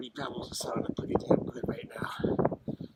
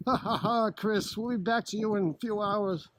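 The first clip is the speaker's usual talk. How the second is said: higher, louder anchor with a fake laugh; news anchor voice; 11 semitones higher, 6 dB louder than usual. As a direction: laugh him off and cut away.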